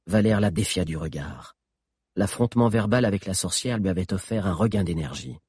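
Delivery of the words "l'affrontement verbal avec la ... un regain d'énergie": usual speaking voice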